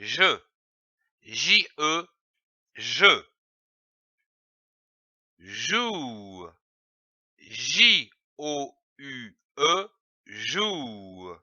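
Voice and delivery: teacher drill, letters spelled between, slow; syllables sounded out. Shown as a demonstration. Teacher sounding out the sentence for a child. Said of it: "Commençons par lire les syllabes".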